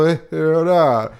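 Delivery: high, loud put-on Italian accent; Enzo Ferrari, mock Italian accent. Reviewer imitating the film's lead for co-host, hammed-up Italian accent. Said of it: "Σε άλλες φάσεις έχει και καλά αυτό".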